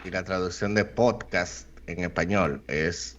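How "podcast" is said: "podcast" with the accent on the O; stress on first syllable. Shown as a demonstration. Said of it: "con acento en la O".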